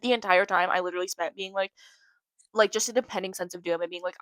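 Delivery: ordinary talk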